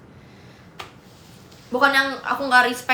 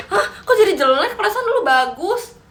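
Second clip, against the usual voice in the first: high-pitched, half-laughing astonishment; mock disbelief; 8 semitones higher, 4 dB louder than usual. Streamer re-enacting seeing her worse phone camera, mock disbelief. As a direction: act baffled, voice swooping up high.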